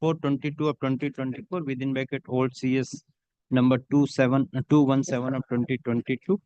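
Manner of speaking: steady, measured dictation of a judgment for the record; dictating a court order. Acted. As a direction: read each phrase out clearly for the stenographer to type.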